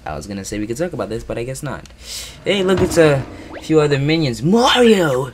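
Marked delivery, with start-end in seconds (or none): 2.4-5.3 high, loud excited call; excited shout. Acted. Minion spots Mario, calling out.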